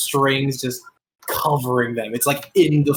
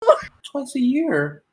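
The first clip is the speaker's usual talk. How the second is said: put-on high-pitched plaintive child; high girlish lament; upset little girl; 10 semitones higher than usual. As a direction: play a dismayed girl, voice lifted high. Game master voices the upset girl.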